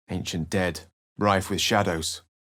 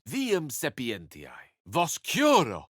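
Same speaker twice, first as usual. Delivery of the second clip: slow incantation; 6 semitones higher, slower than usual. Acted. Latin spell cast in game.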